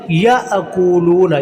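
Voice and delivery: याअकूलूना with ya cut by a catch, then long koo-loo; slow recitation, jolt the first syllable of याअकूलूना; jerk on ya. Shown as a demonstration. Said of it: "या को झटके के साथ पढ़ना". (याअकूलूना में 'या' झटके से)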